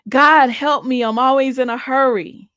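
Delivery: mock the desperate shout of a prayer; lightly relived cry out to God; retold scream to God. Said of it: "And I just screamed out".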